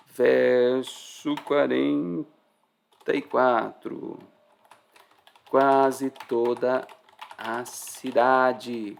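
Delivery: very slow, drawn-out words; deliberate, syllable by syllable; slow emphatic reading. Real, not acted. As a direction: stretch each word for weight. Slows to emphasize the verse.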